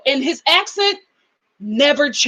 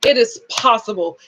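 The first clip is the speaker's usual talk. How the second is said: ordinary delivery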